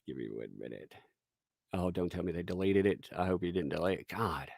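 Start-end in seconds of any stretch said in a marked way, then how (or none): none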